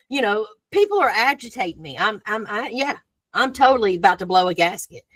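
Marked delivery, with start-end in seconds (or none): none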